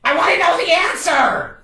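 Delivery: high, loud outburst; exasperated yell. Acted. Gatekeeper left hanging by a riddle, exasperated yell. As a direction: yell out in desperate curiosity.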